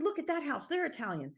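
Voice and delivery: retold excitement, starting very high; excited pointing out. Repeating to her husband that the neighbours are Italian, excited.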